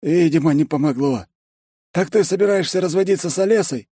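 high-pitched, needling character voice; nosy secretary. A narrator's reading. Secretary prying into the divorce, high and needling. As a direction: press the question high and keen.

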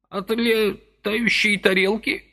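high, slow, rising disbelief on летающей; old man repeating летающей in outraged doubt; indignant echo. A narrator's reading. Old man echoes Vasily's words, high indignant question. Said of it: "С каким-то даже негодованием переспросил".

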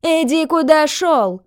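high, loud, drawn-out rebuff; barked shout. A narrator's reading. Landlady driving off a bystander, barking. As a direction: bark at him to back off. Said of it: "гаркнула на него хозяйка".

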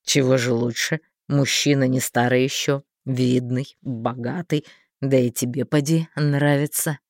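low, coaxing schemer; sly man voice. A narrator's reading. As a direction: coax her, low and sly. Thin man tempting.

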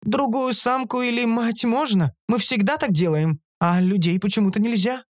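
high-pitched creature argument; creature Оша arguing. A narrator's reading. Оша questioning the ban on people, arguing.

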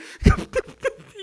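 very high, loud mock sobs; hammed-up crying noise; imitated sobbing. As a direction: mimic wordless blubbering. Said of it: "está llorando".

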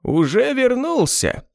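very high, slow, smug taunt; nasty smirk. A narrator's reading. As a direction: taunt him with a nasty grin. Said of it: "с гнусной ухмылкой".

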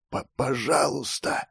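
stammered, faint plea; weak pleading. Acted. Dying boy begs anyone for help, weak pleading. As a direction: stumble into a soft, failing beg.

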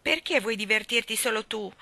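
usual speaking voice

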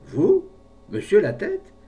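very high, loud rising question; astonished exclamation. A narrator's reading. Brave man hears Latête would buy an annuity, astonished. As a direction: cry out in utter disbelief. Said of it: "Le brave homme s'exclama".

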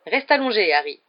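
plain reading voice

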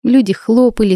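ordinary delivery, nothing marked

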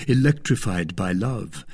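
ordinary delivery

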